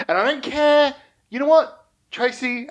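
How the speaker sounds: high, loud defiant blast; defiant outburst. Acted. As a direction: throw it back at her. Defiant outburst on phone call.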